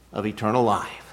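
ordinary talk, nothing marked

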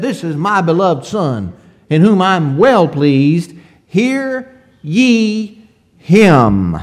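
loud proclamation, slowing hard on hear ye him; voice from heaven. Retold or imitated. Preacher voicing God's voice from heaven at the transfiguration, loud and slow. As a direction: proclaim it from heaven, drawing out the last words. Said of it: "That voice from heaven said".

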